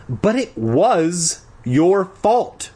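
high, drawn-out protest; protesting cry. A narrator's reading. Boy pushed in the mud protests, high drawn-out cry. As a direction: cry it out slowly, word by word. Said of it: "I cried".

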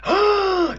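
sharp, loud theatrical gasp. A narrator's reading. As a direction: suck in a high, loud breath.

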